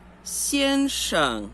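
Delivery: "先生" slow, high first syllable, light short second; first plus neutral tone. Shown as a demonstration. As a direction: stretch "先", barely touch "生". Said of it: "primeiro e neutro". ("先生": primeiro alto, segundo leve e curto)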